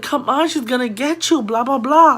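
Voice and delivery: mock sing-out urging; urgent warning. Sister warning the others of the girl behind, urgent.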